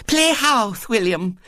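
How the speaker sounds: put-on high, lisping, eager child; eager little girl. A narrator's reading. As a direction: pitch an idea like a keen small girl. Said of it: "said Violet Elizabeth eagerly".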